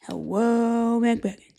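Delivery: put-on sleazy old gentleman voice, partly whispered, drawn out slowly; creepy dandy voice. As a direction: play a leering old gent with a cane, purring compliments. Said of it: "if you talk like that".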